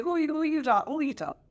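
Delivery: ordinary talk, nothing marked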